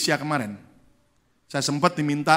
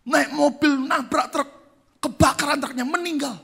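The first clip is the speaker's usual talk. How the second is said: high, urgent voice of a caller with bad news; 12 semitones higher, 4 dB louder than usual. Caller telling the pastor of a fatal crash, high and urgent.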